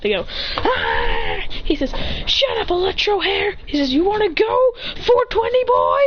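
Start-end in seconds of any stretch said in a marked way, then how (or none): 0.6-1.4 high strained "ah"; high mock growly cry; acted quarrel cry. 2.2-3.6 high-pitched acted snub; angry retort. Acted quarrel. 3.9-6.0 high, crying-out acted taunt; fighting challenge.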